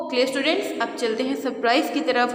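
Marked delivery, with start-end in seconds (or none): none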